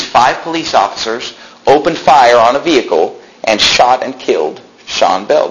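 normal talking voice

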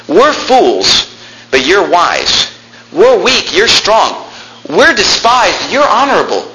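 high, swooping, drawn-out contrasts; sarcastic contrast. Acted. Preacher as Paul comparing himself to Corinthians, sarcastic. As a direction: swing high on each contrast, mockingly.

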